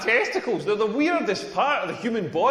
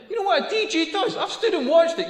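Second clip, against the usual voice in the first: high-pitched rhetorical question; 9 semitones higher, 3 dB louder than usual. Real, not acted. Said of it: "repeated rhetorical question".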